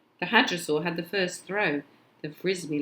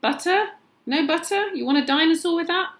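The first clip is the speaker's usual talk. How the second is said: much higher, loud, fast vendor shout; popcorn man shouting; 13 semitones higher, 7 dB louder than usual. Acted. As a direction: hawk it across the lobby, quick and loud. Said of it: "the popcorn man shouting".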